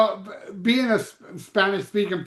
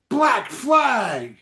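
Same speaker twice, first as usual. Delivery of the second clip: excited greeting; 5 semitones higher than usual. Retold or imitated. Roth greeting Rollins, retold.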